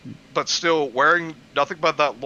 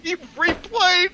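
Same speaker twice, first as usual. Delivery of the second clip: much higher, slow disbelieving squeak; incredulous girl voice; 4 dB louder, slower than usual. Acted. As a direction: drag it out in high disbelief.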